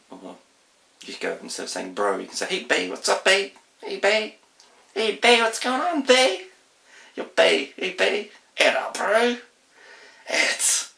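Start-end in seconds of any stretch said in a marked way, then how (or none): 2.5-10.9 high, loud Kiwi street slang; broad kiwi accent. Shown as a demonstration. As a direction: greet your mates the New Zealand way. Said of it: "that's a bit of a Kiwi speak".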